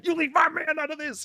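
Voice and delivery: very high, loud imitation of a woman; hammed-up high-pitched scolding; mock indignant protest. Quoting a friend who donated to defend her boyfriend, mock indignant squeal.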